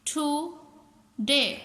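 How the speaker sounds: टुडे as two slow beats, टू, then डे; teacher sounding out टू—डे; split into syllables. Shown as a demonstration. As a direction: say टुडे with a long gap after टु. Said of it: "टू vowel sound देते हों".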